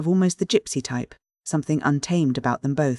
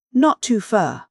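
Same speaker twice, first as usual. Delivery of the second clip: higher and louder, a touch of grumbling dialect; mildly chafed protest; 7 semitones higher, 4 dB louder, slower than usual. A narrator's reading. Driver pushing back at the passenger, mildly chafed. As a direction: push back, a bit fed up. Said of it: "becoming more chafed in temper".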